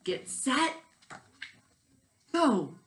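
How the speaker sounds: loud race-start call; starter's call. A narrator's reading. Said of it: "said the kookaburra".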